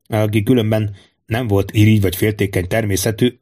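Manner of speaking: ordinary delivery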